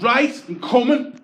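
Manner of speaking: loud, high and slow; bellowed promise from the cab; loud declaration. Retold or imitated. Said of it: "He goes".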